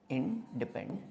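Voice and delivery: Independent broken into in, depen, dent with pauses; low, soft, chunked independent; syllable-by-syllable dictation. Shown as a demonstration. Repeats term in separated parts.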